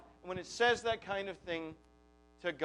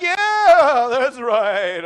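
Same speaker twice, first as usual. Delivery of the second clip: harmonizing agreement; 2 semitones higher, 12 dB louder, much slower than usual. Retold or imitated. Preacher mocking his own heart agreeing with despair, loud. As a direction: chime in as if singing along with despair. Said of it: "I harmonize".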